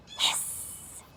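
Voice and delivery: long unvoiced snake hiss. Triangle tricking Square at his door, snake hiss. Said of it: "just like a snake".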